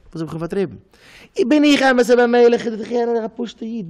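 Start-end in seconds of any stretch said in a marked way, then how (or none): 1.3-3.9 high, loud acted outcry; indignant protest. Storyteller voicing a character's protest, indignant cry. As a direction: cry it out, raised pitch, loud at first.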